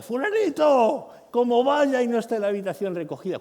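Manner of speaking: an escalated shout, the anger nearly at its peak; mock furious yell. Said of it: "cuidado, cinco".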